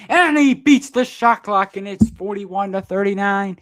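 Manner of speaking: big, high, loud lead-change call; excited call. Real, not acted. Fan commentator erupting as the Sixers take the lead, loud excited call. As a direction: yell it out as the go-ahead shot beats the clock.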